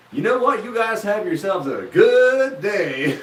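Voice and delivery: high, drawn-out cartoon small-town drawl; small-town American accent. Greeting small-town locals, cartoon small-town American accent. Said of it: "talk like you're from, like, some weird small town in the States".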